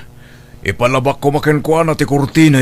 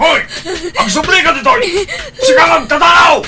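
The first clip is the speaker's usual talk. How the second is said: shouting after her; faster than usual. Acted. Father yelling at the fleeing maid to come back. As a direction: call her back at the top of your voice.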